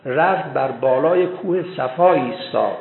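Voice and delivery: normal talking voice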